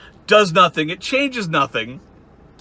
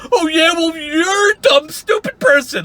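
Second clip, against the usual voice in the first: much higher, whiny mock shout; mock insecure name-caller; 10 semitones higher, 3 dB louder, slower than usual. Grown-up mocking an insecure man's name-calling, high and whiny. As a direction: play the wounded loser lashing out. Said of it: "when they go".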